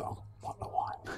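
whispered, slow aside; hushed guilty whisper. Retold or imitated. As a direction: sneak it out under your breath. Sneaking wine while others sleep.

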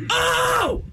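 high shouted Oh with a laugh; Kinison scream. Retold or imitated. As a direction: let out a wild comic yelp. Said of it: "a Sam Kinison impression".

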